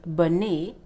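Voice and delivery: bonnet as two syllables, "buh-NAY"; wrongly voiced final E. Shown as a demonstration. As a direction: add "ay" after the N in bonnet. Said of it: "Not bonnet".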